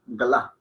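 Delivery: "gla" with the first vowel barely there; short implicit vowel. Shown as a demonstration. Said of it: "tenderíamos a pronunciarla".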